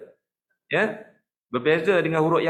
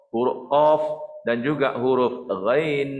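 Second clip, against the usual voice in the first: ordinary talk, nothing marked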